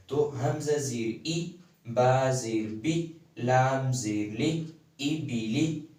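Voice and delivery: slow drill with "ای", "بی", "لی" each clipped short, never stretched; short zer vowels. Shown as a demonstration. Teacher drilling the short zer for students. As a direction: sound out "با زیر بی" slowly with a quick short "بی". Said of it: "جیسے لمبا نہیں کرنا ہے".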